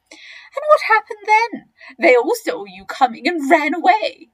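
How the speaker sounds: high, loud challenge; not so big crocodile, mocking.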